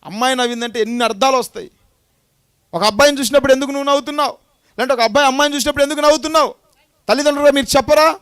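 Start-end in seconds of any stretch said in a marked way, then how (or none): 2.7-8.2 loud, high, fast challenge; preaching at full force. Acted. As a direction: press the question hard and quick.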